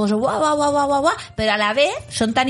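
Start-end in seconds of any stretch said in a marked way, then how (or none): 0.2-1.1 higher, excited run of babble; mock impulsive jabbering; nonstop chatterbox. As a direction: imitate someone who talks and talks. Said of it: "que hablan mucho".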